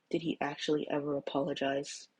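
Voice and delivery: hushed, careful question; said quietly. Acted. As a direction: ask gently and quietly. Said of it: "Shota said quietly".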